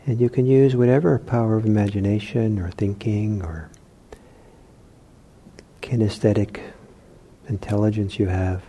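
soft, unhurried meditation voice with long pauses; guided meditation. Real, not acted. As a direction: ease them gently into relaxation, leaving long silences. Leads guided meditation exercise.

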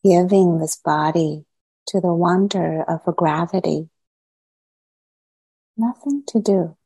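unhurried meditation guide, long silences between phrases; guided meditation. Acted. Teacher easing meditators gradually into body, mind and heart, guided meditation. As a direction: guide them into settling, phrase by phrase.

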